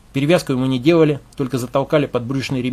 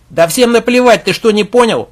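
loud, high, scornful interruption; loud cutting in, much higher and louder; rude outburst; 8 semitones higher, 9 dB louder than usual. Acted. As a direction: bark it over the others. Khronov butts in rudely.

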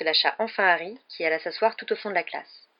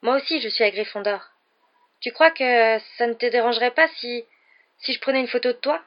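tentative, higher-voiced request; hopeful; 5 semitones higher, 4 dB louder than usual. A narrator's reading. Young fan asking Harry for a photo, hopeful. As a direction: ask timidly, lifting the pitch in hope. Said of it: "le regard plein d'espoir".

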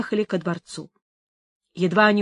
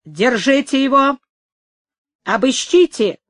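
raised, higher commands; several voices shouting; 4 semitones higher, 5 dB louder than usual. A narrator's reading. Crowd demanding the captive be held and searched, shouting. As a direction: call out orders over the crowd. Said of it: "крикнуло несколько голосов".

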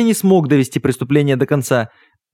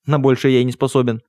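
ordinary delivery

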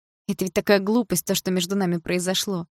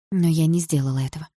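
plain reading voice

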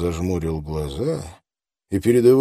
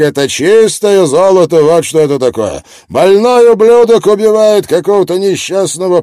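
brash reporter; 8 semitones higher, 9 dB louder than usual. Acted. Brash reporter Miller talks.

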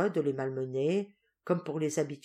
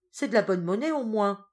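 higher, louder wary question; greedy suspicion; 5 semitones higher, 6 dB louder than usual. Acted. Duenna reaching for the gold, wary. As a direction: eye the money greedily.

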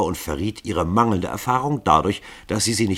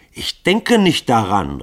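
slow, louder refusal; defiant cry; 6 semitones higher, 8 dB louder, slower than usual. A narrator's reading. Grunja refusing the killers, slow and loud. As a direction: dig in and refuse. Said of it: "rief Grunja".

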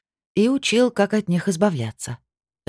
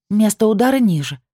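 through gritted teeth; 6 semitones higher, 5 dB louder than usual. Acted. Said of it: "процедила я".

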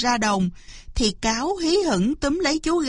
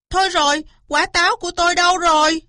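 high, fast cry of alarm; hammed-up panicked shout; loud outcry; 7 semitones higher, 5 dB louder, much faster than usual. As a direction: yell out as if you've been robbed. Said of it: "hét toáng lên".

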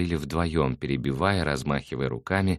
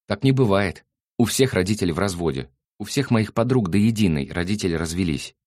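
put-on raised, heated voice of a cross girl; angry girl; 5 semitones higher, 5 dB louder than usual. A narrator's reading. Girl arguing with Nick about parents, angry and loud. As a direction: protest loudly, offended. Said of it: "рассердилась она".

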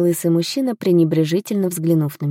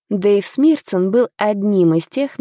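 usual speaking voice

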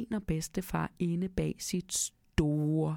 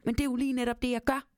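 high, indignant mouse reply; a mouse deeply offended; 6 semitones higher, much faster than usual. A narrator's reading. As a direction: bristle with hurt pride. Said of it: "meget fornærmet".

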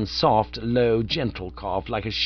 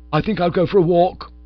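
abrupt; 7 semitones higher, 6 dB louder than usual. A narrator's reading. Heartbroken Bingo leaving.

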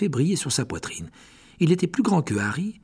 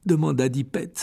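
ordinary delivery

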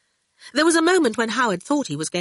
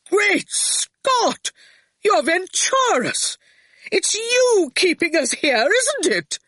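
much higher, a startled exclamation; astonished outburst; 13 semitones higher, 4 dB louder, slower than usual. A narrator's reading. Torquil recognising Venturus in Howard, high and astonished. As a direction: realise who he is all at once. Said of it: "between rage and laughter".

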